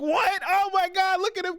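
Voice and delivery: hammed-up excited taunting; jeering kids. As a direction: kids on the lift jeering at him, high mocking shout. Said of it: "everyone's like".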